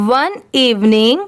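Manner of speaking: very slow reading with long pauses between phrases. Shown as a demonstration. Teacher reads the paragraph aloud for learners, very slowly. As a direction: read it phrase by phrase for beginners. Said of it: "इस paragraph को भी एक बार read करके देखते हैं".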